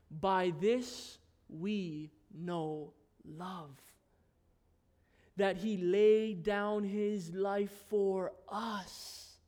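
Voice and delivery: slow, soft reading of the verse; soft scripture reading. Acted. Preacher reading the verse aloud to the congregation: soft and slow. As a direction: read it gently and slowly.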